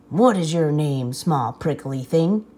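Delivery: low, gruff and unkind; big rhino mocking the small prickly porcupine, gruff and low. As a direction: sneer down at the little porcupine. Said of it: "asked the rhinoceros unkindly".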